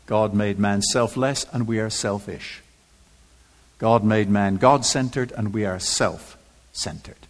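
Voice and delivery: usual speaking voice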